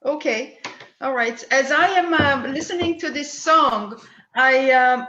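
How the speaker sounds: ordinary talk, nothing marked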